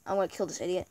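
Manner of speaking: normal talking voice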